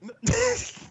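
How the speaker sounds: shrill high-pitched laugh burst; high squeal laugh. Real, not acted. Friend cracking up at the joke, high squeal laugh.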